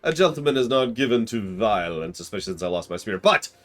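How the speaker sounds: pompous heroic gentleman declaring the arrest; grand hammy detective proclaiming; gallant inspector. Acted. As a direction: puff up like a proud inspector. Voices Hildibrand again.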